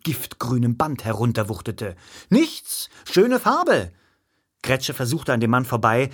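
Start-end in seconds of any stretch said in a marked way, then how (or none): 2.3-3.9 hasty, high-pitched placating reply; high, flustered denial; flustered backpedalling. A narrator's reading. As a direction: jump high in pitch to smooth it over. Flustered hero placates the man.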